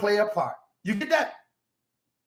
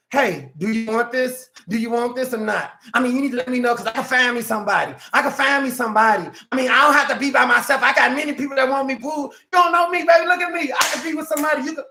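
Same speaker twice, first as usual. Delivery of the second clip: fast, rising plea to keep a man; hammed-up girlfriend begging, then boasting; mock pleading girlfriend; faster than usual.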